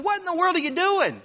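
high-pitched mock disbelief; incredulous father. Father reacting to boy in manure.

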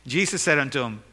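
normal talking voice

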